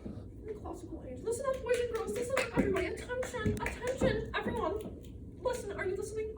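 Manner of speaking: high, fussy call for attention; high-pitched club-president patter; Aunt Emmy. Acted. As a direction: chivvy a restless young audience like an officious aunt. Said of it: "My Aunt Emmy ... would have me".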